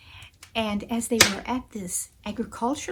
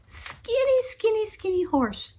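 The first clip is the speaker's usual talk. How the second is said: high, pitying repetition; high-pitched storyteller's sympathy; pitying emphasis; 12 semitones higher than usual. A narrator's reading. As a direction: dwell on how pitiful it is. Narrator pities the starved horse.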